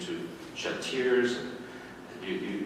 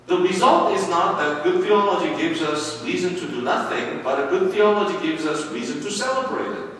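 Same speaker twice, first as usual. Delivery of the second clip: preaching at full force; 9 semitones higher, 9 dB louder than usual. Acted. Pastor preaching loudly to his congregation, full force. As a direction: proclaim it to the congregation.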